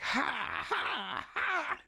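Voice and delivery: high, spaced-out "ha, ha, ha"; mock manic "ha"s. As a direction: imitate a gleeful thief's noises. Imitates tweaker grabbing the lighters.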